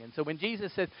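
normal talking voice

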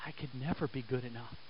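low, hushed, quick confession; soft voice of unworthiness; acted self-doubt. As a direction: drop right down, as a defeated believer. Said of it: "Some of you are here today going".

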